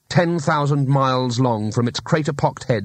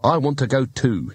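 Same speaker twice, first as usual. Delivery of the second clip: plain reading voice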